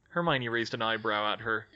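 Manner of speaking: ordinary delivery, nothing marked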